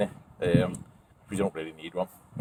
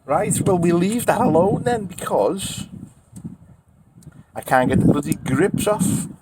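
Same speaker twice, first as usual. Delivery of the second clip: exasperated; 9 semitones higher than usual. Real, not acted. Fed up with the stuck lid.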